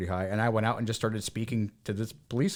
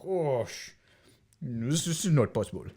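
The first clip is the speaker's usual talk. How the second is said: put-on puffing, sighing French-accented refusal; huff, then refuse haltingly in a French accent; imitated French-Belgian reluctance; 3 semitones higher, 4 dB quieter, slower than usual. Locals answering a rushing American, reluctant French-accented sighs. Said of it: "and they're like".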